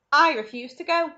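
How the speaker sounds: loud firm declaration; announced. Acted. Grandpa George announces his refusal, loud and firm. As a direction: state it flatly and loud. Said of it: "announced Grandpa George".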